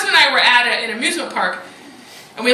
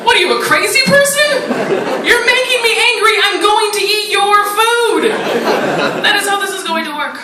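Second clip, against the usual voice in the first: mock outrage; 6 semitones higher than usual. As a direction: scold her, laughing.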